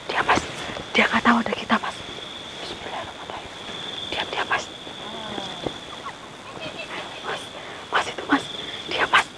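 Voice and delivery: tense whisper so the shaman won't hear. Real, not acted. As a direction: hold it all in a hushed whisper. (berbisik tegang)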